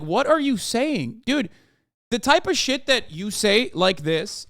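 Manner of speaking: ordinary talk, nothing marked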